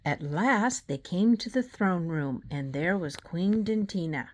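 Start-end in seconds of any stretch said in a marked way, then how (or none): none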